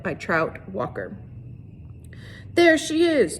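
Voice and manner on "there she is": high, loud accusing shout. A narrator's reading. Trout shouting at the teacher, loud and high. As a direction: yell it out at the crowd. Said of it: "Trout shouted".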